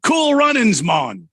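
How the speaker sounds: put-on loud, high joking Jamaican accent; mock Jamaican accent. Cohost wishing a listener a happy Jamaica trip, in a loud mock Jamaican accent. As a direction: toss off a playful Jamaican send-off. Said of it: "I'm not doing the Jamaican accent".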